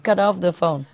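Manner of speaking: normal talking voice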